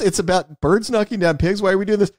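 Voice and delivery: a hint of a baffled studio exec voice; mock Pitch Meeting producer. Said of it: "that character from Pitch Meeting going".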